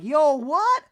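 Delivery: high, loud startled mock Reba; yelped mock-mom question. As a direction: squeal it like a shocked society mom. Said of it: "And Reba's like".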